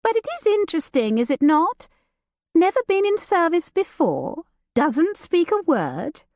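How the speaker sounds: light and teasing, a society lady; Judith, lively young lady. Acted. Judith teasing her cousin about the new servant, playful and high. Judith voiced beside narration.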